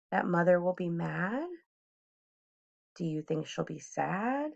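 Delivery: ordinary delivery, nothing marked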